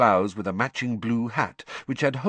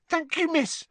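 high, toothy grin in the voice; grinning house elf. A narrator's reading. Dobby thanking Hermione, grinning and squeaky. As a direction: beam it at her. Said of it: "grinning toothily".

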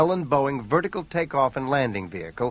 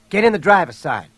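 raised, loud command; pitched-up shouted instruction; urgent order; 5 semitones higher, 8 dB louder than usual. A narrator's reading. Ordering her into car under attack.

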